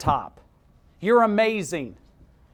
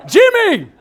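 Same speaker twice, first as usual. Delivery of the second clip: loud, high-pitched mock holler; calling out; 14 semitones higher, 15 dB louder than usual. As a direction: holler the rival's name across the track. Driver calling out to a rival.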